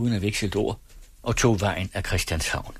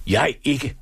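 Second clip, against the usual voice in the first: high, emphatic narrated cry; mild shout of refusal; called out; 6 semitones higher, 3 dB louder than usual. A narrator's reading. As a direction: cry out in protest, pitched up. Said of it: "råbte min onkel".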